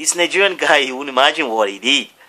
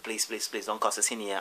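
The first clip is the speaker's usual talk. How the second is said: hushed pleading; 3 semitones lower, 12 dB quieter than usual. Retold or imitated. School staff pleading, acted.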